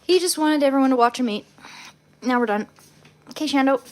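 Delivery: cheerful loud. Real, not acted. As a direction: bounce it out to the dog. Loud cheerful wrap-up to dog.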